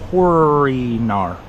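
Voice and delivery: HORINAR stretched out very slowly; slow, puzzled sounding-out of Horinar. Real, not acted. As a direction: draw out each sound of Horinar. Sounding out unfamiliar word slowly.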